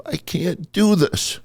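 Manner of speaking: high, slow whimpering mock cry; half-crying whimper. Imitating a crying classmate in the locker room, high whimper. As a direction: imitate a big kid close to tears. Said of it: "whimpering, kind of half-crying voice".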